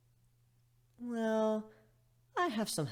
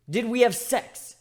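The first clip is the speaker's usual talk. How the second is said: loud, high and slow alarm; alarmed question; 10 semitones higher, 10 dB louder, slower than usual. A narrator's reading. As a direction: demand it in a panic. Alarmed question after waking.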